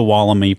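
"Wollemi" ending in "mee", opening like "wall"; non-Australian pronunciation. Shown as a demonstration. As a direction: say "Wollemi" with a final long "ee". Deliberately says it the rejected way.

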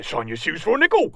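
lightly put-on creature voice; excited Kenku. Excited Kenku to the party, lightly voiced creature. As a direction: offer it in a small creature voice. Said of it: "look excitedly at you and they say".

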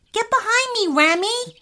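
high, urgent protective order; urgent command. Acted. Older brother shields boy from robot, urgent. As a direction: call it out high and firm.

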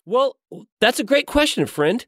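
eager, lifted pastor welcome; Pastor Zach. Acted.

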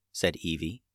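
ordinary delivery, nothing marked

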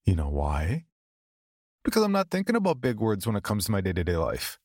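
ordinary delivery

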